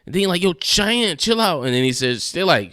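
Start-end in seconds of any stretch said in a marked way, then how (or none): 0.4-1.6 high, urgent mock exasperation; exasperated plea. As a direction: imitate pleading with a screamer to settle. Urging screaming woman to calm down.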